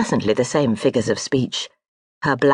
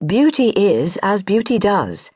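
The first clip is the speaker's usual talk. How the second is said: higher, slow brushing-off of praise; dismissive; 7 semitones higher than usual. Acted. Lady brushing off her admirer's flattery, dismissive. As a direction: wave the compliment away.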